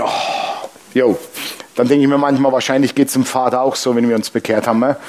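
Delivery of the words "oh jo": weary whispered sigh. Retold or imitated. Grandpa sighing at baby.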